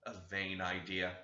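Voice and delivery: plain reading voice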